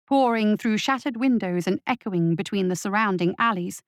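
ordinary delivery

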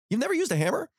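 "You've never used a hammer?" pitched high with rising disbelief; incredulous echo. Retold or imitated. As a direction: repeat it back, stunned. [name] echoes kid in disbelief.

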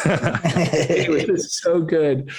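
slow, laughing and relishing every word; laughing savor. Real, not acted. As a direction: drag it out through a laugh, savoring the memory. Savoring remembered sandwich.

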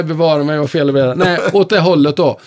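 ordinary talk, nothing marked

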